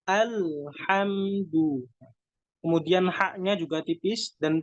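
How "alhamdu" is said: "Alhamdu" said slowly through a smile, hamza thin; smiling thin sound. Shown as a demonstration. Teacher showing smiling "Alhamdu", slow. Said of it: "caranya dengan tersenyum".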